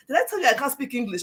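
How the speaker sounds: usual speaking voice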